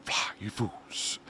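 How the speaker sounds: breathy, drawn-out whisper of a film line; whispered movie quote. Acted. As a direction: whisper it slowly, like a dramatic last word.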